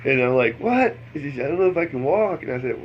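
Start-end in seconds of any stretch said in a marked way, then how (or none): 0.6-1.0 sharp high disbelieving what; can't believe what he hears; surprised. Retold or imitated. Disbelief at wife's claim. 1.4-2.4 put-on higher, more pleading drunk whine; lightly imitating a drunk plea. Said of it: "I'm too drunk".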